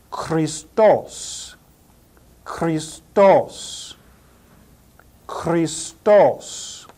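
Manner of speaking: "Christos" said kris-TOSS, final syllable punched; stress on last syllable. Shown as a demonstration. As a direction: hit the TOS of "Christos", pausing between repeats. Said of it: "pronounced with the accent over the last syllable".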